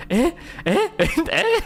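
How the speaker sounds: high, repeated puzzled blurts; imitated bafflement. Streamer mimics teammate pointing at her, amused bafflement. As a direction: mimic his stunned double take, giggling.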